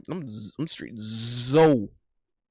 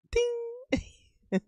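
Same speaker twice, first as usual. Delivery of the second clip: very high sung "ding" like a game bell; ping it out like a quiz-show bell; mock bell ding; 5 dB quieter than usual. Mimics a bell after allegedly.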